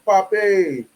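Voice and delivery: slow, drawn-out mock Spanish "papi"; lightly mocking Spanish word. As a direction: toss off "papi" like a lazy Spanish imitation. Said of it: "whatever Spanish words they even say".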